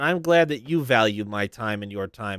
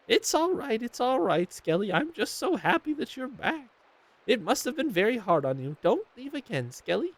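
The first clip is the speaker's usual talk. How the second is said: much higher tender grandma voice; tearful grandma; 10 semitones higher, 3 dB quieter than usual. Acted. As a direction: comfort him, pitched up. Grandma consoles.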